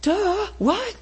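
high, dopey "duh, what?" as the penny drops; dazed realization. Retold or imitated. Repentant believer realizing his sin, dopey dazed voice. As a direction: play a dazed person who suddenly gets it. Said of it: "Repentance is like".

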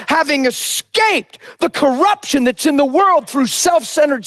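full-force sermon cadence, rising and shouting; preaching. Acted. Pastor preaching hard to his church. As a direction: preach it out to the congregation.

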